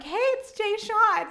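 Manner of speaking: high, drawn-out excited mock squeal; excited fangirl. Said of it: "I'm just all excited texting".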